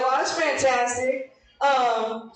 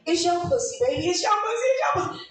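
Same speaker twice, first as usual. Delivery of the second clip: put-on rising higher into a cooing, girlish reassurance; sweet-talking reply; 8 semitones higher than usual. Woman sweet-talking her lover, cooing higher and higher. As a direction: coo it higher each time to please him. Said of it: "our fault for saying".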